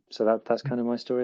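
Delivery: ordinary talk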